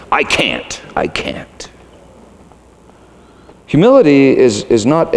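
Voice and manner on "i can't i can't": low, soft imitation of a stubborn ego; mock arrogant refusal. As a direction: refuse softly, sinking almost to voiceless. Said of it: "our ego that arrogantly says".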